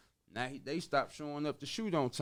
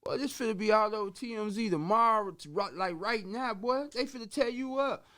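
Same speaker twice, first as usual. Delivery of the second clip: much higher, louder, rushing the warning; retold heated warning; 10 semitones higher, 5 dB louder than usual. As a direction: warn the partner the scandal will blow up. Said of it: "I'm like".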